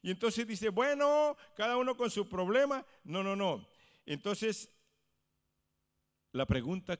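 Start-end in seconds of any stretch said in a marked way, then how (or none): 0.7-2.9 dismissive shrug. Acted. Believer brushing off others' needs.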